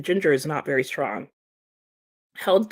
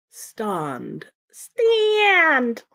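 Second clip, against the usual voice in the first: starlet quote; 7 semitones higher than usual. Retold or imitated. Fan replaying the film line, screechy. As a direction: drag out the vowel like the film star. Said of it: "my absolute favorite".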